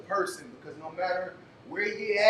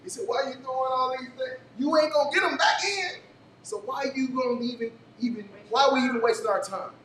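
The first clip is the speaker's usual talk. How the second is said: mock doubtful friend; 7 semitones higher, 4 dB louder than usual. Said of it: "the homie came up to him and he said".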